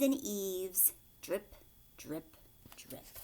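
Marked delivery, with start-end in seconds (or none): 1.2-3.2 fading drips. Acted. Sound words fading away.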